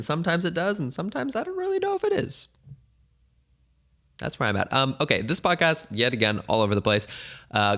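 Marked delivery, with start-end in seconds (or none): 0.0-2.5 much higher, fast squeak; squeaky aside. Pretend. Host joking about his self-cut hair, high squeak.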